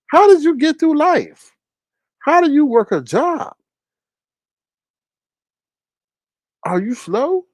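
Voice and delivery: high disbelieving questions; incredulous mocking. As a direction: scold him in disbelief. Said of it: "I was like".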